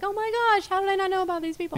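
high, loud gush of amazement; amazed reaction. Retold or imitated. Host recalling her weekly discovery of local groups, high amazed gush. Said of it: "every week I'm like".

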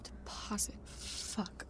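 breathed under the breath in shock; hushed, disbelieving whisper; stunned whisper. Acted. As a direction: whisper it, floored by the price. Shocked muttering at the cost.

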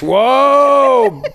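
loud, high, long-drawn "whoa"; mock amazement. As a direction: exaggerate being wildly impressed, laughing. Mocking a one-point poll lead.

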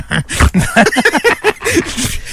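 pig-like snorting laugh. Real, not acted. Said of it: "Qui rire comme un cochon".

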